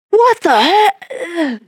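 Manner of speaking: high, drawn-out, slow "What the heck"; stunned boy after prayer; amazed teen. Retold or imitated. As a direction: stretch it out in disbelief.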